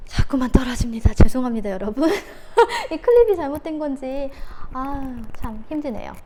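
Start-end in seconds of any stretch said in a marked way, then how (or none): 2.1-2.9 a sudden high, sheepish giggle; real flustered laugh; embarrassed laugh. Real, not acted. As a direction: laugh off the slip. Apologising for a clip glitch.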